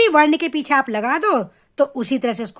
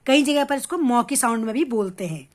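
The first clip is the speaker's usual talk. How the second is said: ordinary talk, nothing marked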